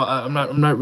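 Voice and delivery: ordinary delivery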